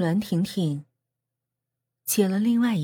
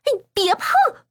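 high, sharp rebuff; stiff, high-pitched refusal; curt refusal; 4 dB louder, slower than usual. A narrator's reading. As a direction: snap at them to keep off. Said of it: "栾婷婷生硬地拒绝他们".

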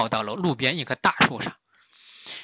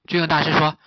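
usual speaking voice

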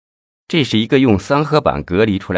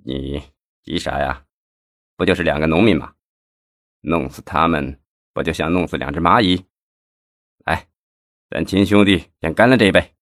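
coldly. A narrator's reading. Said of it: "费庆奇冷冷地说道".

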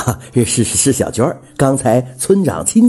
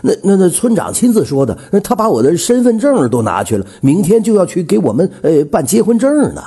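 stammered, higher-pitched protest; flustered insistence; 6 semitones higher than usual. A narrator's reading. Man defending his engagement to the widow, flustered. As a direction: insist while tripping over words.

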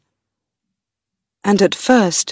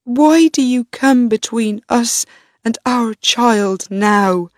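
crying out; 3 semitones higher than usual. A narrator's reading. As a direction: plead in raw anguish. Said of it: "cried Rhoda".

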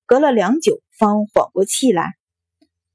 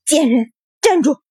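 high, raised angry yell; furious roar; 6 semitones higher than usual. A narrator's reading. Wife going after the concubine, furious roar. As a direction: hurl the insult and command in fury. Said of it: "吼道".

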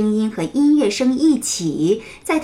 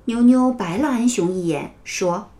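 ordinary delivery

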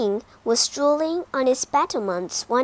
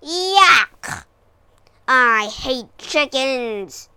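snarled; 8 dB louder, much slower than usual. Acted. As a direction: spit it out in disgust. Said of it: "snarled the dragon".

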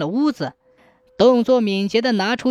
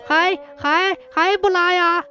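high-pitched, stammering urgent call; flustered master shouting, much higher voice; anxious calling out; 8 semitones higher than usual. A narrator's reading. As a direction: call out to the butler, tripping over the words in a fluster. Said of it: "就大声地喊".

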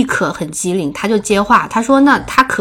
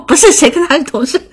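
higher, disgusted brush-off; disdainful denial; 6 semitones higher than usual. Retold or imitated. Narrator denying to bar staff she is his coworker, scornful. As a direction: push the idea away with scorn. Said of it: "然后我就嫌弃".

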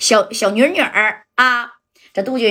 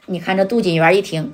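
ordinary delivery

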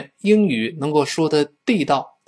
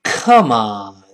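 loud, low-falling angry Come on; a K-sound Come on hurled out in anger; foreigner's angry Come on, heavy and drawn out; 4 semitones lower, 5 dB louder than usual. Acted. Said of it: "老外一生气说".

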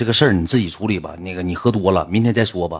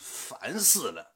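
mock annoyed woman; 2 semitones higher, 15 dB quieter, much slower than usual. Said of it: "我给你模仿一下女性当时发那个微信".

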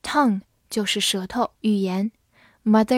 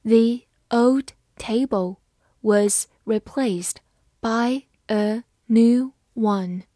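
slow, word by word, phrase by phrase; slow reading; slower than usual. Shown as a demonstration. Teacher reading slowly for students to follow. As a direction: read it slowly for the learners. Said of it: "好，慢慢来读。".

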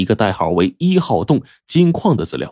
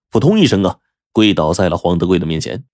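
plain reading voice

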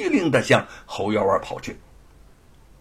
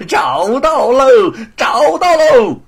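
loud, high, slow triumphant yell; excited shout; 12 semitones higher, 11 dB louder, slower than usual. Acted. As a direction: crow over the find. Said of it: "兴奋地大叫起来". (兴奋地大叫)

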